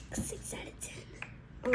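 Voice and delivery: slow, hushed whisper. Real, not acted. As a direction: let the rating drop to a whisper. Trails off rating drink.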